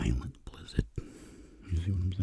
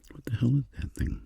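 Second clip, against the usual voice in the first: Tony voice; 9 semitones higher than usual. Pretend. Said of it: "and he's like".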